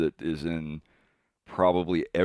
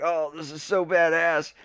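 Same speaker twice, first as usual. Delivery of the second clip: excited gush; 10 semitones higher, 4 dB louder than usual. Retold or imitated. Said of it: "and I was like".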